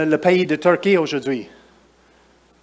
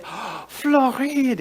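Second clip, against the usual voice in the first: high, drawn-out delighted "Oh, Floride!"; delighted surprise; 9 semitones higher, much slower than usual. Retold or imitated. Québécoise physio charmed to hear of Florida. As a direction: marvel at the name like a charmed local. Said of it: "Elle est québécoise".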